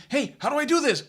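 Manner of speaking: mock bewildered question; brain asking. Teacher voicing the student's brain asking how, lightly puzzled. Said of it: "that tells the brain".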